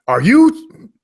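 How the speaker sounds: high, loud and pumped; radio hype voice. Shown as a demonstration. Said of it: "you gotta have that radio voice".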